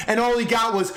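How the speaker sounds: ordinary delivery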